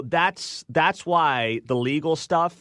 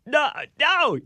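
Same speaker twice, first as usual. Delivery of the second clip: mock disbelief; 12 semitones higher than usual.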